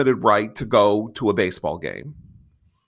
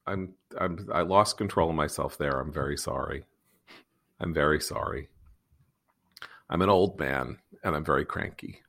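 much softer and lower, mumbled contrition; sheepish apology; 11 semitones lower, 10 dB quieter than usual. Real, not acted. Host apologizing to listeners after his rant, much softer and sheepish. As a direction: sink the voice, embarrassed and sorry after the outburst.

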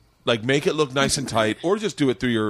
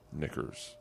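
whisper; 8 semitones lower, 16 dB quieter than usual. Real, not acted. Trailing off, searching name.

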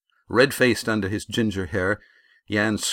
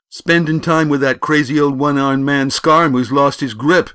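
high, loud rush of scorn; loud jeer; 7 semitones higher, 11 dB louder than usual. A narrator's reading. Bully mocking his rival's old teacher, loud jeer. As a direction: hurl the insult at full volume.

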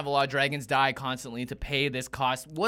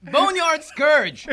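dramatic card announcement; 10 semitones higher, 7 dB louder, much slower than usual. Real, not acted. Announcing card while burning mouth.

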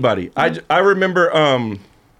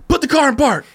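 mock guard order; 11 semitones higher than usual. Retelling a security guard's order, high mock bark. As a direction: play the pushy guard barking up high.

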